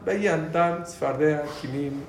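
ordinary talk

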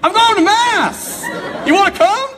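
high, bright mock cheer, slow; chirpy invite. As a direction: sing it out like an annoyingly cheerful early riser. Cheerful early-morning invite.

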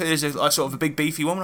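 usual speaking voice

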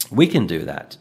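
ordinary talk

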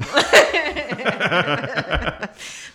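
a loud burst of embarrassed laughter; real, loud laughter. Real, not acted. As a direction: laugh it off loudly after the slip. Laughs after a slip.

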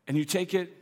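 normal talking voice

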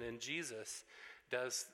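usual speaking voice

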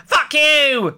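high, loud shout through laughter; hammed-up parting yell; mock storm-off. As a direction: yell it like a comic diva exit.

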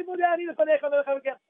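high-pitched rattled-off "Modeh Ani lefanecha"; rote recital. Shown as a demonstration. Rabbi showing how not to say the morning prayer, rote recital. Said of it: "Don't say".

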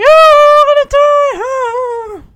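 high, loud, drawn-out wail of doom; hammed-up dying cry, stretched out high; mock panicked wail. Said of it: "you're scaring the children".

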